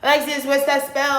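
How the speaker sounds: high, loud whiny plea; loud mock whine asking for a spell; mock needy caller.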